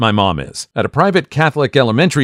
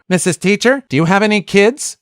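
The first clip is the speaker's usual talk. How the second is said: put-on kid voice; 9 semitones higher than usual. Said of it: "students asked her".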